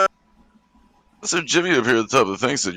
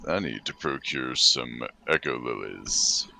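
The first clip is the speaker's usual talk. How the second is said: very low and soft; discreet request; 10 semitones lower, 8 dB quieter, slower than usual. Acted. Player quietly asks the shop lady, discreet low voice. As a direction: drop down to a hushed, deep voice.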